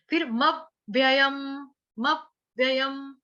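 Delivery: मव्ययम said very slowly syllable by syllable; careful slow drill; slow demo. Shown as a demonstration. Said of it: "slow word demo".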